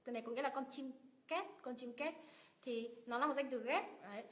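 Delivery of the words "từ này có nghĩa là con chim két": normal talking voice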